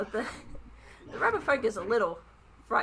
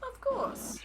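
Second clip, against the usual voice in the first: higher, eager little author voice; rabbit folk author voice. Acted. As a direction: play the shy, flattered rabbit writer.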